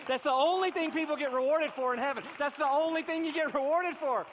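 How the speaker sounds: loud, high full-force preaching. Acted. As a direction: preach it out to the whole church.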